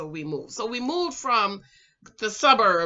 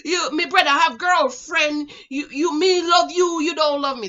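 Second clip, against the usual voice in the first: higher, indignant mock Jamaican rant; mad Jamaican boy; 7 semitones higher, 6 dB louder than usual. Jilted boy mad that she loves his brother, indignant Jamaican accent. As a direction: argue hotly in an imitated Jamaican accent. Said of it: "he was mad".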